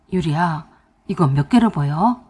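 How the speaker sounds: low-pitched, careful question; lightly voiced husband checking on someone; concerned husband. A narrator's reading. Husband showing finger to dazed wife, concerned.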